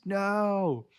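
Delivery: high-pitched mock scream, drawn out; Meryl's emphatic scream. Podcaster retelling Meryl's reply to Truman, mock scream. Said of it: "Meryl emphatically screams".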